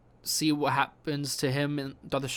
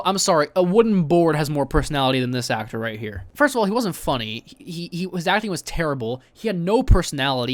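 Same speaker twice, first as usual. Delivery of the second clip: ordinary talk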